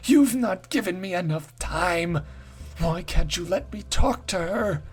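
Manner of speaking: high, strained otherworldly voice, slowing at the end; possessed pleading. Acted. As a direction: plead in a voice not quite your own. Possessed woman pleads with spirit.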